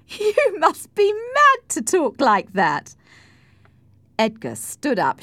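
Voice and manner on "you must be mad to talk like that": slow, loud, very high outburst; scornful cry. A narrator's reading. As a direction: exclaim at such madness. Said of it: "cried Cathy".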